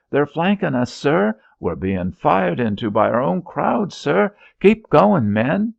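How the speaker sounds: high, loud desperate yelling; frantic shouts. Acted. Soldier shouting warnings to his officer under fire. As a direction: cry the warning over the gunfire.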